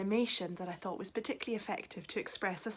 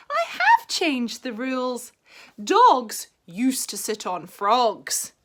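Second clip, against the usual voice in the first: proud frog; 4 semitones higher, 10 dB louder, slower than usual. Acted. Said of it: "said the frog".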